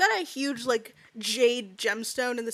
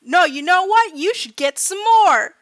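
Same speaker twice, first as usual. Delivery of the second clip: higher, louder taunting yell; mock yell; 6 semitones higher, 7 dB louder than usual. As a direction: shout the dare across the room. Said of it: "gets up and yells".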